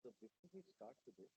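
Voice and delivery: faint, far from the mic; barely audible. Real, not acted. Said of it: "Sir, your voice is very faint".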